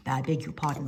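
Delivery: ordinary delivery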